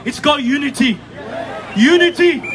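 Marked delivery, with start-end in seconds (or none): 1.8-2.4 loud rallying shout. Real, not acted. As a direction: hit it hard to the crowd. Rally speech climax, single word.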